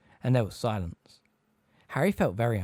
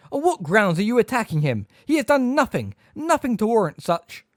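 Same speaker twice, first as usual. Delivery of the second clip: high, loud outraged demand; indignant shout; 10 semitones higher, 7 dB louder than usual. A narrator's reading. McGonagall confronting Hagrid's attackers, loud and indignant. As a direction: demand they justify themselves.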